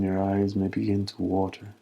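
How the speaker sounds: ordinary talk, nothing marked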